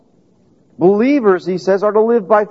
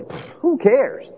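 slow, scoffing brush-off; dismissive scoff; 7 semitones higher, 4 dB quieter, slower than usual. Pretend. Preacher voicing listeners who shrug off a distant promise, dismissive scoff. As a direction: wave it away with a pfft. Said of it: "most of you would say".

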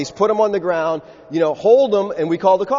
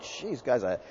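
low, slow muttered aside to himself; mock mutter at the departing man; 6 semitones lower, 7 dB quieter than usual. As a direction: mutter it under your breath, half to yourself. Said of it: "and I'm like".

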